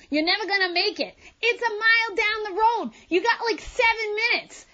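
mock police officer. Police officer telling her to move her car, mock bossy voice.

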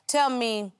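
ordinary talk

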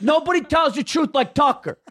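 high, insistent New York dad; dad's New York accent. Retold or imitated. Son imitates dad praising Tucker, emphatic New York accent. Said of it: "hear my dad say the name Tucker like that".

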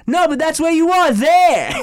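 high, loud goofy movie quote; Austin Powers impression. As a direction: deliver it smugly to a stranger. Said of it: "And I'm like".

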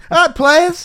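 high, loud hype-man call; over-the-top, high and loud; mock hype greeting. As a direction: jokingly hail the class like players. Suggesting silly alternatives to "guys.

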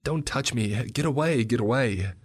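put-on high, loud child's scream; panicked kicking scream; screaming girl. A narrator's reading. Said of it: "started to scream".

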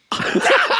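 big bursting laugh; hearty laugh. Real, not acted. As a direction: let out a full belly laugh. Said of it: "a bountiful laugh".

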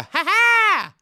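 loud high "ha ha"; imitated loud laugh from the crowd; loud cackle. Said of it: "I heard a".

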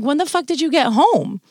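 shocked yell at a husband strolling in late; retold angry outburst. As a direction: blast him for sneaking home. Acted outburst at husband walking in.

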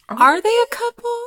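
high, squeaky mock question; imagined listener. Listeners wondering about the hosts, asked in a high mock voice. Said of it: "you're like".

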